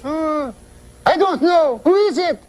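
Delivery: very high French woman's voice; puzzled wife in a put-on high pitch; Mary Claire. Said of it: "She goes".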